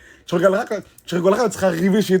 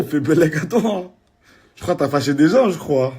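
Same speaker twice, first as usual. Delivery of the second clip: ordinary talk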